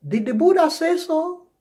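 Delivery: high-pitched gentle query; nice correction. Acted. Coaching listeners to correct people nicely, sweetly high questioning. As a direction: ask it nicely, voice lifted high. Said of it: "You nicely kind of correct it".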